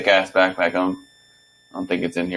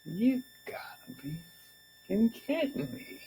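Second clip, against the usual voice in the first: hissed in a furious whisper, much higher; seething whisper; 13 semitones higher, 14 dB quieter than usual. Retold or imitated. Staring down the boss who cancelled his bonus, furious whisper. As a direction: whisper your disbelief through rage. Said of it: "I was so freaking angry".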